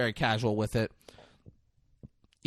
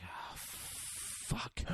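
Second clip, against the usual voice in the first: breath let out in a hushed curse; dismayed whisper; 18 dB quieter than usual. Retold or imitated. Acting out the cop finding his ride gone, dismayed whisper. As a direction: whisper it as the bad news sinks in.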